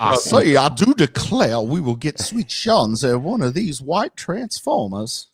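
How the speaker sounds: mock Southern accent, stretched at the end; Southern belle accent. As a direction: drawl it like an old Southern lady. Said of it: "sounded way more racist than that".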